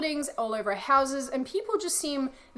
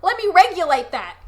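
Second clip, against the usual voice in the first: loud, higher-pitched mocking government; gleeful government; 6 semitones higher, 9 dB louder than usual. The government taunting someone having fun, gleefully. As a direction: play the government, laughing loudly at people's fun. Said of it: "The government's just like".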